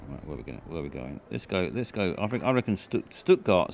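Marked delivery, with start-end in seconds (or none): none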